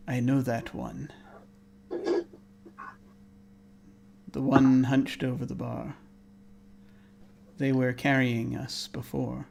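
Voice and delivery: soft, hushed, measured murmur; whispering voice. Acted. Unseen presence behind Juliet, soft whispery murmur. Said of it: "the voice whispers to you".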